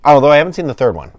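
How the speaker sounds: ordinary talk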